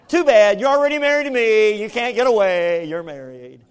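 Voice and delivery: loud, high teasing shout; playful teasing. Retold or imitated. Husband loudly teasing his wife she can't get away. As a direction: tease her loudly and gleefully.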